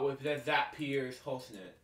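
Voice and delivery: ordinary talk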